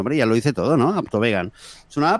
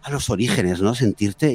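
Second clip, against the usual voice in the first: ordinary delivery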